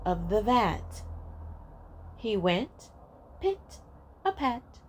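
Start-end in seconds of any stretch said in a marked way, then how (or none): 3.4-4.8 high tiptoe voice. A narrator's reading. Light patter of cat feet.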